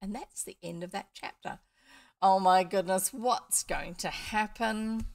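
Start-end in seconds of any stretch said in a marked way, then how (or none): 2.2-5.1 mock suspense. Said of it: "mock suspense after chapter".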